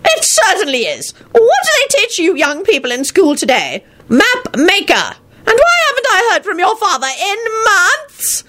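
put-on high-pitched scolding old man; old grandfather's high, indignant voice; crotchety old wizard. Old wizard rebukes the children.

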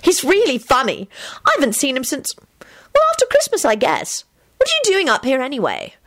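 very high, cheerful female character voice; high, delighted chatter; beaming. A narrator's reading. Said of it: "Rosie beamed".

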